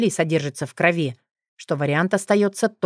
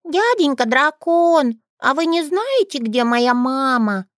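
put-on little girl voice; 10 semitones higher, 5 dB louder, slower than usual.

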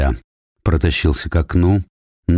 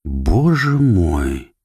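very slow, overcome with feeling; choked with emotion, drawn out; shaken; slower than usual. Acted. Shaken old man welcomes children. (потрясённо, медленно)

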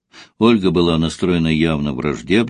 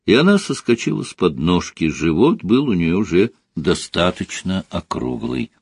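ordinary delivery

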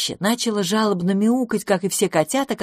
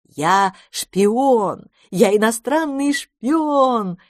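higher, slow gleeful chant; joyful sing-song; 5 semitones higher, 4 dB louder, much slower than usual. A narrator's reading. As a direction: sing it out with delight. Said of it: "пел мистер Браун". (радостно, нараспев)

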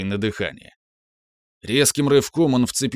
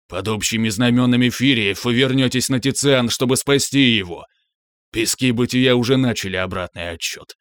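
dark lord. Acted. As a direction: command like a doomed prophet. Monster's grandiose monologue.

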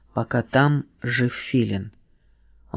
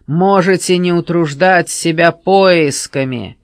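sneering panto villain; 3 semitones higher, 7 dB louder than usual. A narrator's reading. Villain mocks heroes.

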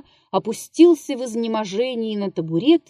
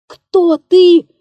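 sharp, high, loud cry of fright; terrified scream; 10 semitones higher, 10 dB louder than usual. A narrator's reading. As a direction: scream it in a voice not your own. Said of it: "крикнул он резким, не своим голосом".

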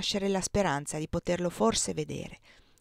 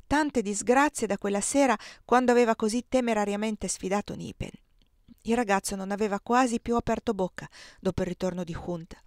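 plain reading voice

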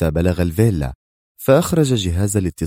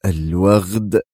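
a flushed, understated curse; mild furious exclamation; much slower than usual. A narrator's reading. Lawyer curses the guard who hung up, furious. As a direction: spit the insult out, hurt pride. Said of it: "احتقن وجه المحامي بشدة وهو يهتف".

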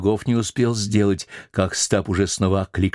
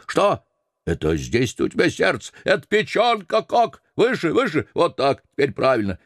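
high, commanding mate voice; bossy sailor; 5 semitones higher than usual. Acted. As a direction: lord it over the old cook. Stubb orders the cook about.

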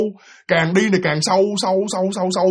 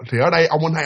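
ordinary delivery, nothing marked

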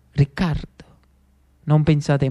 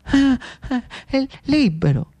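high, hesitant stammer; timid young man; 8 semitones higher than usual. Acted. Lucio telling the Jacobin how he feels, timid and halting. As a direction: falter before finding the word.

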